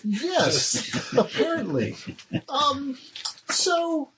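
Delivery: high, laughing noble lady; amused Crystal. Acted. Crystal is amused by her fan, pitched high.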